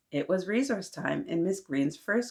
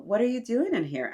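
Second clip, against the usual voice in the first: stern teacher; 6 semitones higher, 4 dB louder than usual. Acted. Asked Miss Green.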